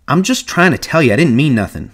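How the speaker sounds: rattled self-justifying tone; defensive. A narrator's reading. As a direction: insist you meant no harm. Curly defends himself again.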